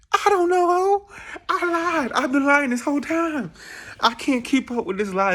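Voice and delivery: high, loud mock wail; hammed-up wailing confession. Confessing the Brooklyn lie to questioners, high mock-desperate wail. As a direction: cry it out like a melodramatic breakdown.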